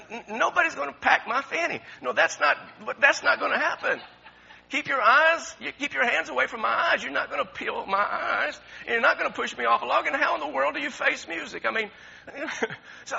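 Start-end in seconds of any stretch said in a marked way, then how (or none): 0.1-11.7 high, louder mock protest; indignant misunderstanding. Preacher playing a foreigner baffled by American idioms, mock indignant. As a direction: protest the idiom as if taken literally. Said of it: "somebody from another country or whatever. They'll say".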